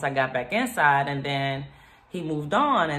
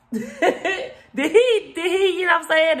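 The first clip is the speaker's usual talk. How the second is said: high, louder laughing incredulity; laughing disbelief; 12 semitones higher, 4 dB louder than usual. Real, not acted. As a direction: laugh in shock at the mess.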